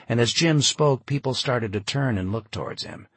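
ordinary delivery

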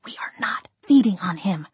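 unvoiced fierce whisper; hissed whisper. A narrator's reading. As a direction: mouth it so only he hears. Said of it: "I snarled and mouthed so only he could hear".

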